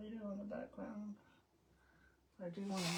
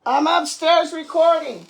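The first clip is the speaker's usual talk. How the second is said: louder repeat, punching "upstairs"; yelling across the room; 8 semitones higher, 27 dB louder than usual. Real, not acted. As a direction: yell it again, leaning on "upstairs". Calls again, louder, stressing upstairs.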